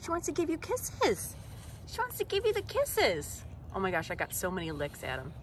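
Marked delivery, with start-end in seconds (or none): 0.0-3.4 high, soft singsong. Real, not acted. Telling about the puppy's kisses, soft high cooing. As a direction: coo it teasingly with a drop at the end. Puppy licking, baby talk.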